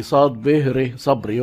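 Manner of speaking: صاد ب ر صبري slowly, letter by letter; spelled slowly. Shown as a demonstration. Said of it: "بيتكتب زي العربي". (تهجئة صاد ب ر صبري ببطء)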